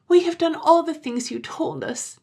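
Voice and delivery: put-on high child voice crying out; high childish insistence; crying children. A narrator's reading. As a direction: insist like eager little children. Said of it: "the children cried again".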